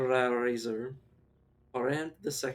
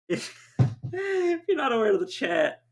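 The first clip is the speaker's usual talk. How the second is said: laughing; 11 semitones higher, 5 dB louder than usual. Real, not acted. Still laughing while explaining.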